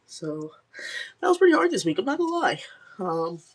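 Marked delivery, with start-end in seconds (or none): 1.2-3.4 higher and louder, choked with tears; real tears, laughing through them at the end; teary. Real, not acted. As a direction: push through the words while crying. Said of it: "you're boohoo crying on here".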